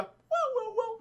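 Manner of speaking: high hooting chirp; little creature's high warble; imitated dinosaur call. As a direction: hoot like a small dinosaur.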